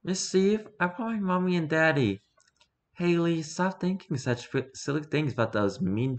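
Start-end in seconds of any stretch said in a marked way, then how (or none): none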